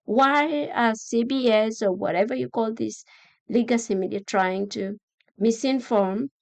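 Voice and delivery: ordinary delivery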